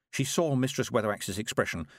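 ordinary delivery, nothing marked

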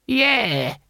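high, drawn-out agreement; whining old warrior. A narrator's reading. Boy Willie backing up Cohen's complaint, high old-man whine. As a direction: chime in with a high old man's voice.

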